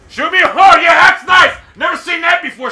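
loud, high, indignant sarcasm; sarcastic outburst. Real, not acted. Gamer shot through a wall, sarcastic shout. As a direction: yell it with bitter mock admiration.

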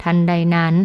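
ordinary delivery

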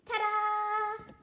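very loud, high, sustained flourish; triumphant ta-da. A narrator's reading. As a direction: belt out a showy reveal. Celebrating the shared find.

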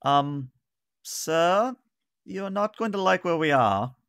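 high-pitched officer breaking bad news; halting, high character voice with pauses; Varissa. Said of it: "Barissa says".

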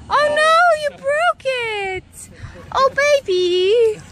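high, loud, slow mock shock. Real, not acted. Baby broke cake decoration.

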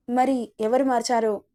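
usual speaking voice

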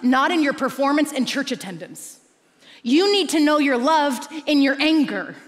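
loud, driving sermon delivery; preaching at full force. Acted. Preacher urging the church, loud and driving. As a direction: press the point home to the congregation.

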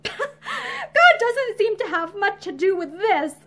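slow, high, laughing through tears; a miserable laugh that turns to crying; pathetic. Acted. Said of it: "she said pathetically".

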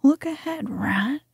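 a high, urgent whispered cry; sudden whispered warning. Acted. The mole warning the rat before the crash, urgent whisper. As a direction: call out the danger fast. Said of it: "cried the mole suddenly".

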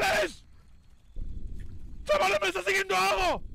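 high panicked call to friends. Real, not acted. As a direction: yell for help, scared. Calling friends while chased.

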